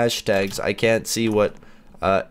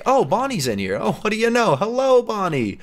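mock cheery greeting; 10 semitones higher than usual. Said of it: "It's very like".

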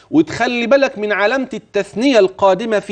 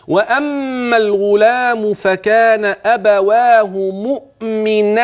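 slow raised melodic chanting; Quran recitation; 4 semitones higher, slower than usual. Shown as a demonstration. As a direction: chant the verse slowly. Recites the verse.

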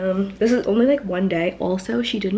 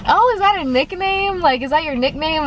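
much higher curious cashier voice; mock cashier; 9 semitones higher than usual. Retelling the cashier asking about her nickname. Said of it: "he was like".

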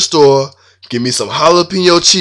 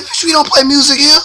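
high comic shout; mock shout; 12 semitones higher than usual. Shouting down his girlfriend's request, high mock shout. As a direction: bark it up high.

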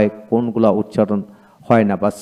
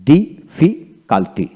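very slow difficulty with gaps between syllables; broken into syllables; 3 semitones higher, much slower than usual. Shown as a demonstration. Teacher breaking difficulty down for learners, slow syllable by syllable. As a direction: stretch difficulty out in separate beats. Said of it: "এইভাবে ভেঙে ভেঙে".

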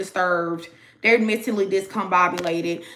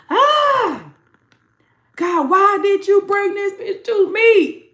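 high, loud, slow wail to God; exasperated partner; 9 semitones higher, 5 dB louder, slower than usual. Acted. The regretful person wailing about the Jezebel, a high loud slow lament. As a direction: cry out as the fed-up partner, dragged out.

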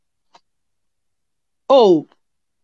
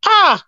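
cry of dismay; 9 semitones higher than usual. Real, not acted.

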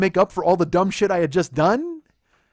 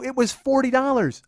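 higher pitch, less forceful than a yell; insistent repeat; 7 semitones higher, 4 dB louder than usual. Retold or imitated. Boyfriend repeating the price to his doubting girlfriend, insistent.

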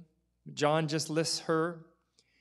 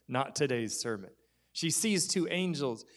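ordinary talk, nothing marked

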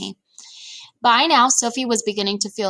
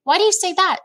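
put-on much higher storybook child voice; questioning girl; 10 semitones higher, 4 dB louder than usual. A narrator's reading. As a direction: ask as a puzzled little girl, pitched high. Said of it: "Sophie asked".

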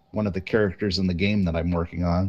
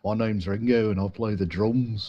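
put-on Liverpool accent. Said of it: "No, no, Liverpool".

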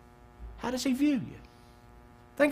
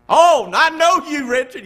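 louder, higher mock scorn; mock scornful retort; 8 semitones higher, 9 dB louder than usual. A doubter scoffing at the preacher, in a loud mock sneer. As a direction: jeer like a doubting acquaintance.

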